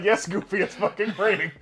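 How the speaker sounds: mock exasperated, laughing as you answer; laughing exasperation. Retold exasperated reply, laughing.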